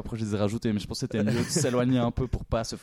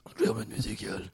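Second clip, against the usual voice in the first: hollow, booming cave voice, softer; voix caverneuse; 5 dB quieter than usual. Shown as a demonstration. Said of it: "Ou alors avec une voix caverneuse". (voix caverneuse et démoniaque)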